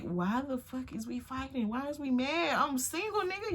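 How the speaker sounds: retold indignant whine; annoyed. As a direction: protest in a high, exasperated voice. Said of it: "I start getting more annoyed".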